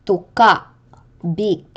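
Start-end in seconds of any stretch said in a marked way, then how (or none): 0.1-0.7 Tuka with loud stressed -ka, cut short by glottal catch; acute glottal stress. Shown as a demonstration. Teacher showing end stress, Tuka stressed last with glottal catch. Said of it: "pronounced with a glottal catch".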